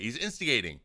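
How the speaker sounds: normal talking voice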